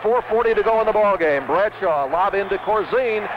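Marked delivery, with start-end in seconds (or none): none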